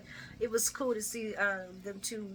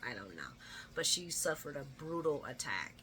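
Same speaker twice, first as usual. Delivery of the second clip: ordinary talk, nothing marked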